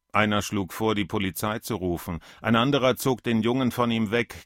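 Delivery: usual speaking voice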